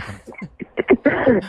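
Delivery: put-on slow, hesitant try at a Canadian French accent; Canadian accent attempt. Host teasing the caller, trying a Canadian French accent. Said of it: "tu maîtrises un peu le, l'accent".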